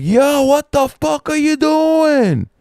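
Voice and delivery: high, loud, drawn-out retold shout; alarmed yell. Crew yelling at the man by the panel, stretched high shout. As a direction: holler it with the last word stretched.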